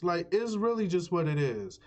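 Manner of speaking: ordinary delivery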